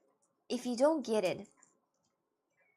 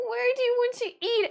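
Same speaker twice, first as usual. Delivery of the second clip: much higher, louder squeaky ask; high-pitched exasperated asking; high mock question; 12 semitones higher, 6 dB louder than usual. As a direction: mimic pestering someone to decide. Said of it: "And I'm like".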